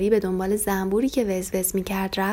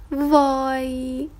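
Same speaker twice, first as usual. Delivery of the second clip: long drawn-out, higher wow of alarm; startled wow; 5 semitones higher, much slower than usual. A narrator's reading. Lamb Farfari sees the big tractor, drawn-out startled wow. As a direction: gasp it out slowly at the big thing.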